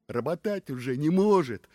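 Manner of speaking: plain reading voice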